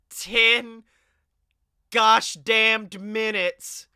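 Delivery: TEN, gosh damned minutes, each slow and separated by pauses; exasperated emphasis. Acted. Host chides rambling co-host, "ten gosh damned minutes" slow and emphatic.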